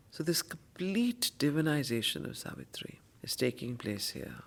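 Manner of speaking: unvoiced whispered remark. Teacher whispering a remark about the poem. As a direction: hush it like a secret. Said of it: "whispered comment".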